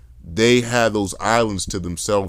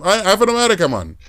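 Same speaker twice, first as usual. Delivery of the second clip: high, louder imitation Geechee accent; African-sounding Geechee accent; 11 semitones higher, 4 dB louder than usual. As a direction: insist in a mimicked Sea Islands accent. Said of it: "African accent".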